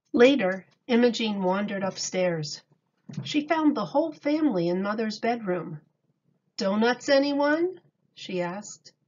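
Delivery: calm storybook reading voice; reading to children. A narrator's reading. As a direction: read it to a class of little kids. Reads picture book aloud.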